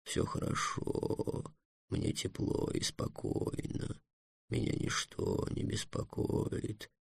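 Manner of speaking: очень тихий, медленный полушёпот; успокаивай себя еле слышно, протяжно; убаюкивающий монолог. Acted. (тихо и медленно, почти шёпотом)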